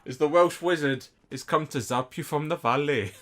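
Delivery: hammed-up Welsh; Welsh accent. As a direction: announce him as a Welsh wizard. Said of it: "I don't know what that accent was".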